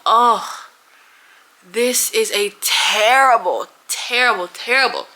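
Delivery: slow, drawn-out terrible, terrible, terrible; dread. Real, not acted. New mother recalls the painful IV spot, slow and drawn out. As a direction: stretch out each terrible in dismay.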